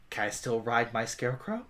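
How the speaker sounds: low, very soft sulky question; sullen request. Acted. Scolded adventurer asking to keep her ride, low and sullen. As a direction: ask it low and guarded. Eyes narrowed to slits, scolded character.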